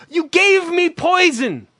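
high, slow hammed-up despair; mock desperate customer. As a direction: wail like a poisoned parent. Acted complaint of poisoned customer.

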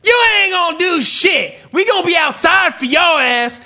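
high, loud taunting threat; mock threatening shout. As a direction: yell a threat at the guy. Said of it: "the performers said".

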